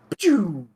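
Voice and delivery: loud, high vocal gun zap; imitated sniper shot. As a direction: fire off a cartoon rifle noise.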